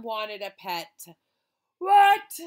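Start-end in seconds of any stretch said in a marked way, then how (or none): 1.8-2.4 loud, high outraged "What"; shocked cry. Acted. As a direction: shout it in disbelief. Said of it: "cried the boy's mother".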